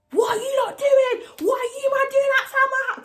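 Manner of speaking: high-pitched, frantic shout out the window. Retold or imitated. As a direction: yell it down at them, pitched right up. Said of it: "This is how I'm shouting".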